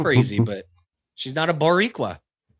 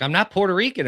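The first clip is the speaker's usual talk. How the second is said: quoting her protest; 6 semitones higher than usual. Retold or imitated. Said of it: "She's like".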